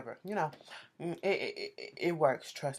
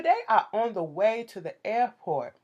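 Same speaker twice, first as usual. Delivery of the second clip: ordinary talk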